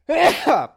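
sudden sharp sneeze burst; stifled sneeze. Real, not acted. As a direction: let the held sneeze explode. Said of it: "Ich muss niesen".

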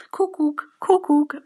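high two-note bird call in words; cuckoo call. Shown as a demonstration. Joke teller imitates the bird saying its own name, cuckoo call. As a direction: chirp the word in a high falling call.

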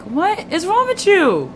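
very slow, high-pitched disbelief; retold incredulous outburst, stretched out; exasperated retort. Retelling the same exasperated retort.

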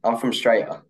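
"Australia" shortened to "Straya"; Aussie slang pronunciation. Shown as a demonstration. Host showing the guest how it's said, "Australia" as "Straya". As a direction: say "Australia" as "Straya", no opening vowel, no "l". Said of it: "How would you say that?".

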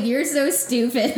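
loud and high, through laughter; laughing loud rebuke. Retold or imitated. Teasing her fiancé over his silly business name, loud laughing rebuke.

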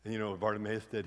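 ordinary talk

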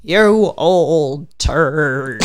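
put-on very slow trembling old woman; shaky old lady voice. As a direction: quaver it slowly.

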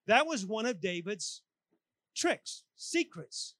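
ordinary talk, nothing marked

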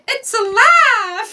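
high, loud horrified scream; hammed-up horrified shriek. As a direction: shriek it in mock horror. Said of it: "she screamed".

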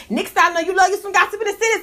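ordinary talk

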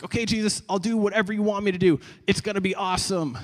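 high-pitched, gung-ho enthusiasm; eager promise. Retold or imitated. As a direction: act out a keen, naive volunteer. Acts out his eager promise.

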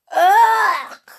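long, loud, high-pitched "ugh"; drawn-out squeal of disgust. Acted. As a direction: wail of revulsion at a nasty taste. Moo tastes cheese, pulls face.

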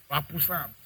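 Our Psalms said as puh-salms, P sounded; child's puh-salms; P pronounced. Retold or imitated. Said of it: "She's pronouncing the P".

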